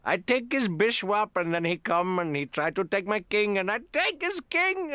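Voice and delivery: put-on high, excited Indian accent. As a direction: boast in a strong Indian accent. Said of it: "strong Indian accent".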